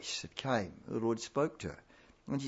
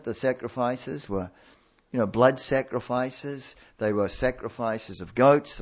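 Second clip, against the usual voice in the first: ordinary talk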